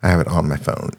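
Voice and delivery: usual speaking voice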